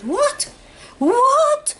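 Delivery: high shocked scream of disbelief. Retold or imitated. Woman hearing terrible news, screaming in shock. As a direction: shriek it, stunned. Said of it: "And I started screaming".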